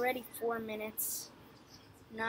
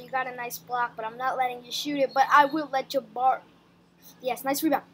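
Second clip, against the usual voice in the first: usual speaking voice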